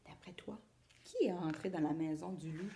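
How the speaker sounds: low, conspiratorial whisper. Acted. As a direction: lean in and ask quietly. Aside question to child.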